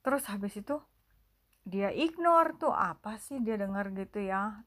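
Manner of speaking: ordinary delivery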